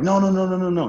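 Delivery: urgent protest. Retold or imitated. Ken urgently stopping him.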